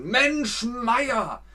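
high, exasperated groan; exasperated sigh. Shown as a demonstration. Stuck in traffic, high exasperated groan. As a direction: moan stuck in a jam. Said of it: "Dann sagt man".

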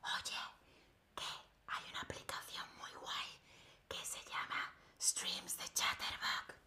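whispering a secret into an ear; whispered message for the telephone game. Shown as a demonstration. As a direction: breathe it into their ear, voice off. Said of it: "se dice decir al oído".

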